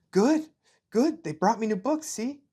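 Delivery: put-on high, bright child voice, words tumbling out; a little boy proudly showing off; eager young boy. Ainz shows his mother his new books, high eager child voice.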